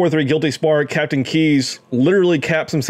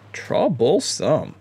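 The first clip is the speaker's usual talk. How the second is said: Troublesome drawn out slow and soft, falling; a lazy theatrical Troublesome; savoured mock drawl; 3 dB quieter, slower than usual. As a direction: roll Troublesome slowly like a sly villain. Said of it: "gonna be troublesome".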